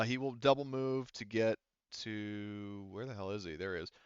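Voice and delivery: normal talking voice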